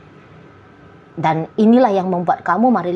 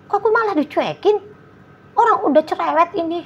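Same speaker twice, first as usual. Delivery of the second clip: much higher, whining mock complaint; whiny ignored partner; 10 semitones higher than usual. Partner complaining about being ignored, in a much higher whiny voice. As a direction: squeak out the hurt of being ignored.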